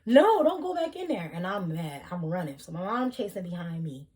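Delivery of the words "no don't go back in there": high, loud call from mom; a mother yelling after her daughter, higher and louder. Retold or imitated. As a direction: mimic mom calling her back. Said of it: "my mom is trying to, like, yell for me".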